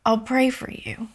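soft unvoiced whisper, calm and kind; calm and sweet. The calm stranger promising prayer, whispered softly. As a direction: say it gently, as if she knew. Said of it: "She was so calm, and she said".

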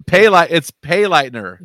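"Pay Lightner" slowly, with a clear long "pay"; corrected pronunciation. Shown as a demonstration. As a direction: sound out "Pay Lightner" as two stressed parts. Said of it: "Did I say that right".

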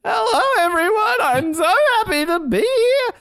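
put-on high cracking teen voice; pimply teen voice. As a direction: squeak it out like the Simpsons pimply kid. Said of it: "the pimply pizza face guy".

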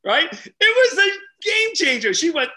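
usual speaking voice

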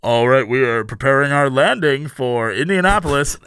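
low-voiced imitation of a pilot's announcement; airline captain. Captain announcing the landing to passengers, imitated pilot voice. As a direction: put on a low captain-on-the-intercom voice. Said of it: "the captain comes on, is like".

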